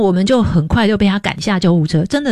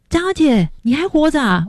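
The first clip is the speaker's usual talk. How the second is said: much higher, disbelieving cry of relief; astonished; 9 semitones higher than usual. Retold or imitated. Old church mother on the phone, stunned, high disbelief. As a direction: gasp out that she's still alive. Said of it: "她听到我就说".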